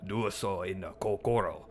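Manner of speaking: a hint of a mock Korean accent, solemn wise sage. Playing a wise sage for chat, lightly put-on Korean accent. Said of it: "continues the accent bit".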